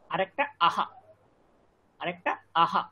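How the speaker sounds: আহা said with a breathy h after আ; visarga demo. Shown as a demonstration. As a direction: add a clear h sound to আহা. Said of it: "বিসর্গের উচ্চারণ আমরা সবসময় একটা হ যোগ করব".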